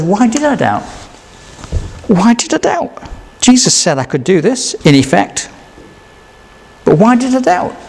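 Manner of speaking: lightly voiced self-questioning; Peter doubting. Peter asking himself after sinking, mildly distressed. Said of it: "Peter says".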